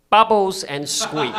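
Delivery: high, slow, loud mock British accent; hammed-up posh British voice, drawn out. Comic hamming a British accent for the crowd. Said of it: "you want me to do the accent".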